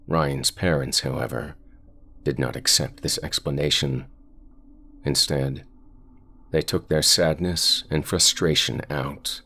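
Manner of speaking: ordinary delivery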